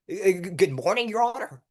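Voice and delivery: mock the fake trial-lawyer voice; fake mock accent. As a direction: stiff artificial "Your Honor" delivery. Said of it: "that sounds fake".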